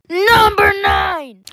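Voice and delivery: shouting. Acted. Countdown announcement.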